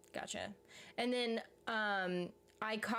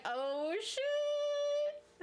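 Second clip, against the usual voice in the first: surprised; 3 dB louder than usual. Retold or imitated. Reacting to being caught.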